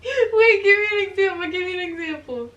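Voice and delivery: high, loud interruption easing off; playful protest. Real, not acted. Girlfriend challenging her boyfriend's complaint, playful protest. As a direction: cut in high and insistent, then ease off.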